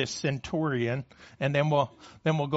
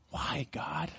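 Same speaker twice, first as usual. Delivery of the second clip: a soft, breathy near-whisper to God; hushed plea; 10 dB quieter than usual. Acted. Preacher asking God why, hushed and pleading. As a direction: let the question fall away quietly.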